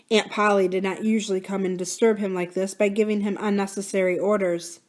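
plain reading voice

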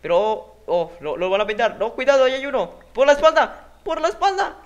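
high, loud urgent warning; urgent shouting. Real, not acted. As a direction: yell the warning to your teammate. Warning teammate during firefight.

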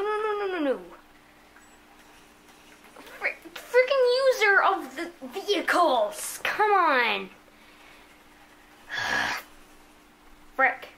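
ordinary talk, nothing marked

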